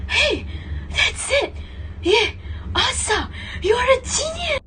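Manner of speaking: excited. Acted. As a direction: blurt it out in delight. Excited discovery of the answer.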